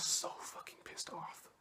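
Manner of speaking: hushed whisper, almost to yourself; whispered aside. Real, not acted. Jabbing at Pompeo under your breath, whispered. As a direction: drop to a whisper for the jab.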